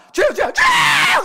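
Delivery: loud, high prayer shout with a drawn-out last cry. Retold or imitated. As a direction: cry out to the Lord three times at full voice. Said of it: "주여 삼창하고 기도나 하고 갑시다". (주여 삼창하듯 목청껏 길게 외치는 소리)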